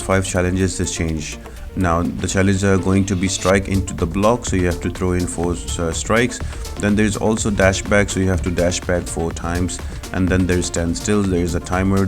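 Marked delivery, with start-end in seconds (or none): none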